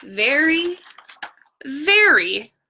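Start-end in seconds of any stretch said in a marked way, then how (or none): none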